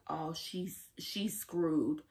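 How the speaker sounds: soft, low, ominous; hushed dread. Retold or imitated. Vlogger whispering dread as Rue hears the dog, soft and low. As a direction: murmur it like a warning.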